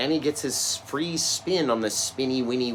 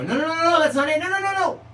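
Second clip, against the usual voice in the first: high, loud, squeaky no-no-no; mock protesting viewers; 9 dB louder, faster than usual. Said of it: "because people go".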